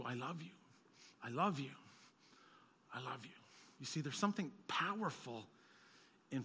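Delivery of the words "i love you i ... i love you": tender love. Retold or imitated. Gentle declaration of love.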